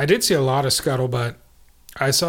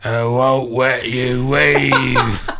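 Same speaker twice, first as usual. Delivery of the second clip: very slow, louder, with a laugh breaking through; spooky ghost voice; 5 dB louder, much slower than usual. Acted. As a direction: drag it out like a haunting spirit. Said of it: "That's the voice I was doing".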